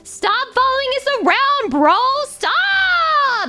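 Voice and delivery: high, crying pleas of stop, bro; mock-tearful guard shouting stop; whiny pleading security guard. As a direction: beg him to stop in a shrill cry.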